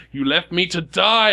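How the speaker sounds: screaming accusation. Acted. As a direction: wail it out slowly like an angry ghost. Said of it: "he starts screaming and screeching at you".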